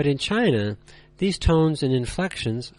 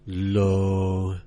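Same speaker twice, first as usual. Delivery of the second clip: "low," held deep, lower and flat; low level tone; 5 semitones lower than usual. Shown as a demonstration. Teacher showing learners the third tone, low and flat. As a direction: drop "low," to the bottom and hold it. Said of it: "Try it again".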